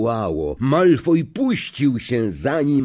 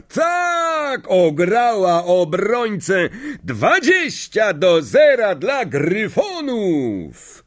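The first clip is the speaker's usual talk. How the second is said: much higher, slow triumphant shout; thrilled goal call; 9 semitones higher, 3 dB louder, much slower than usual. Acted. Lee to the crowd, triumphant goal call. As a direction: celebrate the goal.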